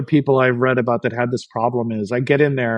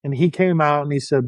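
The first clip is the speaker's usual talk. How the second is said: ordinary delivery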